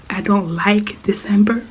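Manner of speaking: whispered. Real, not acted. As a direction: whisper to rest the voice. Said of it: "I shouldn't talk".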